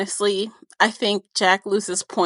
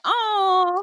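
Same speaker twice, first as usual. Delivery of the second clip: mock sad oh; 10 semitones higher than usual. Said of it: "I'm like".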